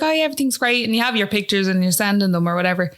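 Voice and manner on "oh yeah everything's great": breezy. Retold or imitated. Breezy front to family.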